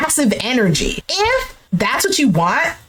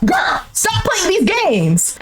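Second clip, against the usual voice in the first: high, drawn-out scolding; exasperated high-pitched call-out; 6 semitones higher, slower than usual. Real, not acted. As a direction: plead with her, dragging each word out. Exasperated at the woman's flirting.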